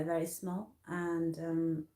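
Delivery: ordinary delivery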